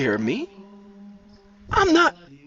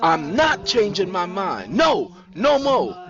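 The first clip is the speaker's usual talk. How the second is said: raised, emphatic, slowing at the end; defiant refusal; 7 semitones higher than usual. A narrator's reading. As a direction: dig in and draw out the no. Nisi refuses to return.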